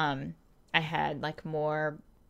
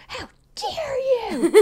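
high, slow indignant wail; mock outraged cry; 5 dB louder, slower than usual. Teen daughter shouting back at her mom, hammed-up indignation. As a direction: stretch it out in exaggerated offense. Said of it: "just so angry".

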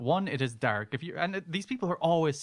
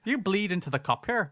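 higher-pitched mock Northern Irish; Barry; 6 semitones higher than usual. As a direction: give orders in hammed-up Northern Irish. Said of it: "That's our great Northern Ireland accent".

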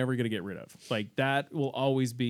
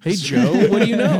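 loud greeting; 6 semitones higher, 12 dB louder than usual. Acted.

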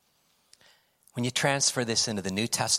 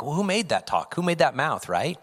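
louder comeback; 6 semitones higher, 5 dB louder than usual. Retold or imitated. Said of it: "I'm like".

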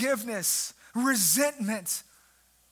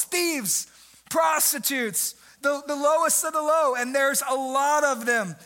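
raised, pressing preacher's voice; preaching at full force; 3 semitones higher than usual. Acted. Preacher rouses church about outcasts, full force. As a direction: drive it home to the congregation.

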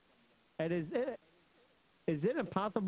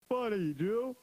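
loud, high voice with mocking laughs; scoffing soldier; 6 semitones higher, 5 dB louder than usual. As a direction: scoff loudly between bursts of laughter. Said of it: "Oh, the soldier said".

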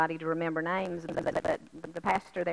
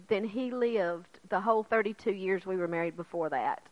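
ordinary delivery

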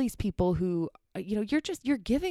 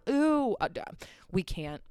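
ordinary talk, nothing marked

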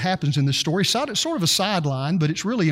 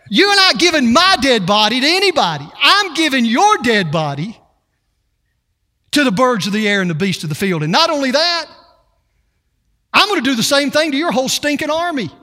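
loud, rising defiant boast; defiant trash talk; 4 semitones higher, 4 dB louder than usual. Retold or imitated. David answering Goliath's taunt, defiant and louder. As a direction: throw it back in his face. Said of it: "Do you see the attitude".

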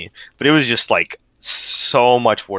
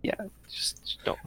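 whispering; 14 dB quieter than usual. Real, not acted. Hushed final advice.

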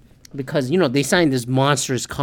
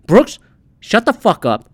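loud, higher-pitched angry retort; exasperated telling-off; 5 semitones higher, 5 dB louder than usual. Acted. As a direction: snap at him to shut up. Acted angry retort to golfer.

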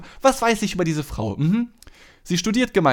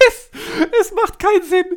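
high-pitched laugh through the words; 4 dB louder than usual. Real, not acted. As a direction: squeal it out while laughing. Laughing at own ironic situation.

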